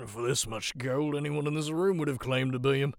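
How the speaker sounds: laughing through the words, tipsy; drunken chuckle. Acted. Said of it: "The man chuckles drunkenly".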